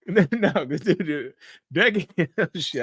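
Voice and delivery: words squeezed out through laughter; can't help cracking up; real helpless laughter; laughing. Real, not acted. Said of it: "I'm not laughing at you".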